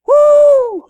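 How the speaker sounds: a sudden high, loud whoop of delight; excited whoop. Retold or imitated. Preacher mimics a thrilled moviegoer with a loud whoop.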